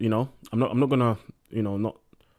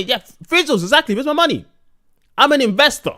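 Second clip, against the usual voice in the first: excited; 13 semitones higher, 7 dB louder than usual. Real, not acted. Jokingly reacting to chat.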